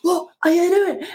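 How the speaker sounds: high, exasperated shout about the glasses; mock angry shout. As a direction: yell at the sun as if it's to blame. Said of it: "I could just shout at the fact that the sun came out".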